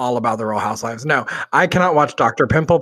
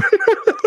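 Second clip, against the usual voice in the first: shrill high burst of a laugh; high squealing laugh. Real, not acted. Laughing after the story.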